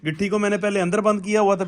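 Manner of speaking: ordinary talk, nothing marked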